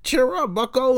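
high, sing-song fake cheer; mock cheery customer. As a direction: mimic a pushy friendly customer. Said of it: "they wanna be like".

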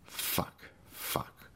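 whispered curse; muttered under breath. Acted. Gus cursing the jammed chair under his breath. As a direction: swear under your breath. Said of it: "Gus muttered under his breath".

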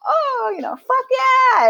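very high-pitched, elated imitation; retold delighted cheer. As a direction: soar up high into a mock cheer. Voicing friend's delight at kill.

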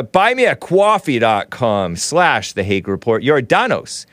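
normal talking voice

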